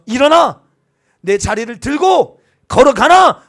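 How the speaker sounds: loud, slow proclamation; proclaimed command. Shown as a demonstration. Pastor shouting Jesus's order to the sick man, slow. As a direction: thunder it out as a command. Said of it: "보십시오".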